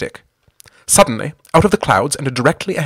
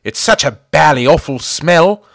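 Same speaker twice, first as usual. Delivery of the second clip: rueful; 4 semitones higher, 6 dB louder than usual. A narrator's reading. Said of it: "added Ginger ruefully".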